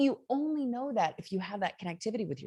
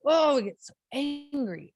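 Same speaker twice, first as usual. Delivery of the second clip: mock anger; 7 semitones higher, 6 dB louder than usual. Mock angry outburst.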